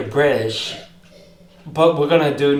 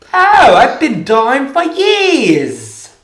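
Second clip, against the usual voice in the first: higher, louder, slow Cockney line, "dying" stretched out; Cockney accent; 11 semitones higher, 9 dB louder, much slower than usual. Shown as a demonstration. Actor demos his stage Cockney accent to friends. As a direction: show off the Cockney accent, hammed up. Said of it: "to doing a Cockney accent".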